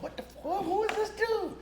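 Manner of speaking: high, hushed disbelief; mock the baffled casting crew; high and soft, puzzled; stunned whisper-ish disbelief. Casting people reacting.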